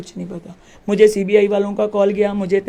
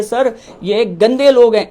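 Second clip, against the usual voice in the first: contemptuous quote; 5 dB louder, slower than usual. Retold or imitated. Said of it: "उन्होंने ये word use किया".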